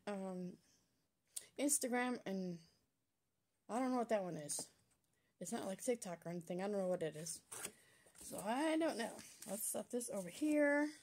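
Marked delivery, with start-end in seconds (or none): none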